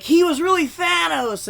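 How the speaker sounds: mock shocked reveal. Historians revealing Columbus's new crime, mock shock. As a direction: announce it like a scandal. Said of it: "they're gonna be like".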